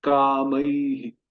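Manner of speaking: कामई drawn out very slowly with an echoed "hi"; very slow demo. Shown as a demonstration. Teacher asking students to listen carefully, very slow. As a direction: stretch कामई slowly as "kamai-hi". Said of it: "ध्यान से सुनिए".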